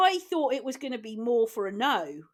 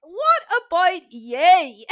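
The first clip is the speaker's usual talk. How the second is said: louder, slow Irish greeting; Irish accent; 5 semitones higher, 9 dB louder, much slower than usual. Shown as a demonstration. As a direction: stretch it out Irish-style. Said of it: "You always have to go".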